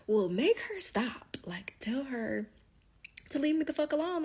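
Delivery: rising, heated retold demand; mock angry demand. To her man about his baby mama, heated demand. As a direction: tell him to fix it, getting hot. Getting mad at him.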